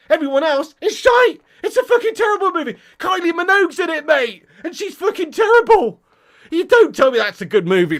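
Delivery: high, loud outburst of disbelief; exasperated rant. Real, not acted. Heated rant against the film.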